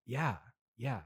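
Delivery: normal talking voice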